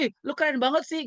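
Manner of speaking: high-pitched mock admiration; teasing. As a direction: imitate teens ribbing a friend who sings. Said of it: "ngeledek-ngeledek kan mereka ya".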